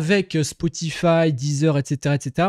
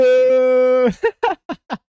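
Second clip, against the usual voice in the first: loud, high bursts of laughter; loud cackle; 8 semitones higher, 6 dB louder than usual. Real, not acted. Streamer after his bot bans a viewer, cackling. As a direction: crack up loudly.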